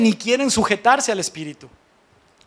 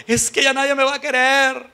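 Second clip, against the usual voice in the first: woman's despairing lament; 4 semitones higher than usual. Acted. Preacher voicing women who feel bound to marry, imitated lament. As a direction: lament it like a hopeless young woman.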